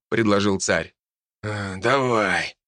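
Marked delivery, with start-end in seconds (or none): 1.4-2.6 slow, unwilling agreement; reluctant. A narrator's reading. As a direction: agree with a sigh. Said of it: "неохотно согласился раненый". (неохотно)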